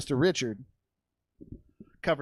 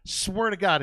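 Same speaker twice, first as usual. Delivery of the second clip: usual speaking voice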